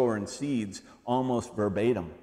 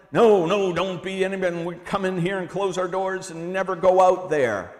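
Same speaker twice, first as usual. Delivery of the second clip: mock church voice; 5 semitones higher, 4 dB louder than usual.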